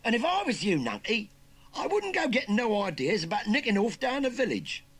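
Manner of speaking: loud, high Cockney threat; cocky warning. Acted. Youngster warning uncle against escaping, cocky. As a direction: warn him off, brash and loud. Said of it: "warned the cocky youngster".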